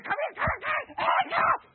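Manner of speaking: high, loud frantic calls after the puppy; frantic calling. Retold or imitated. Preacher reliving chasing his puppy, frantic high calls. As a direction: shout after a runaway dog, panicking.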